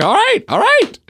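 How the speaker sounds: very high, squealed mock compliance; a scolded kid hastily backing down; flustered giving-in. Kid gives in to dad's warning.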